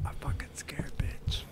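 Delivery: hushed whisper, hiding from monster; whispering while hiding. Real, not acted. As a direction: whisper so it can't hear you. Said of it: "I'm an A S M R".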